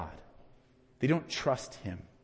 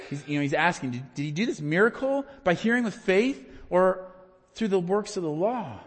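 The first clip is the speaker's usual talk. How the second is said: ordinary talk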